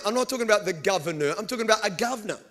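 fast quick clarification; fast aside. Real, not acted. Preacher clarifying to the crowd, fast aside. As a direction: rattle it off.

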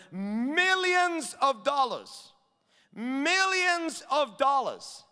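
MILLIONS stretched out slow and high; drawn-out emphasis. Real, not acted. Preacher marvelling at the servant's huge debt, MILLIONS drawn out. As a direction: lean long and hard on MILLIONS.

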